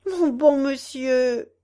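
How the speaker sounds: slow, drawn-out plaintive plea; lightly acted tearful appeal; tearful old woman. A narrator's reading. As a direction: plead slowly as a weeping old woman. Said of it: "dit une vieille femme qui pleurait".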